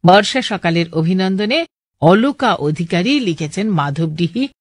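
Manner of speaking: ordinary talk, nothing marked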